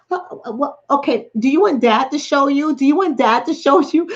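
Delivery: high, insistent teasing questions; pestering mom act. Retold or imitated. Acts out mom pestering her son.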